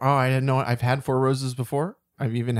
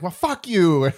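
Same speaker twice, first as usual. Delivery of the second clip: louder and higher, a hammed-up retort; mock angry caller; 6 semitones higher, 6 dB louder, slower than usual. Podcaster retelling the movie's shop-owner caller with a mock angry retort. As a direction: toss it off like a fed-up shop owner, half-joking. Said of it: "and then he's like".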